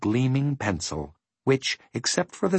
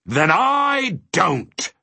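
high, drawn-out, thundering refusal; booming stubborn declaration; 11 semitones higher, 4 dB louder, much slower than usual. A narrator's reading. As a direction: thunder it slowly, pitch way up. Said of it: "he thundered again".

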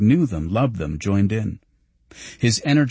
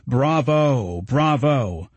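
higher, drawn-out cheer, held back in volume; mild narrated shout; 4 semitones higher, much slower than usual. A narrator's reading. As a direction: cheer like a concertgoer, understated. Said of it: "shouting in an enormous voice".

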